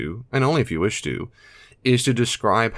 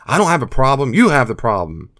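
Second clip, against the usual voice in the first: louder, higher accusing retort; defiant addict; 4 semitones higher, 6 dB louder than usual. Pretend. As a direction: throw the blame back hard. Addict's retort in role-play.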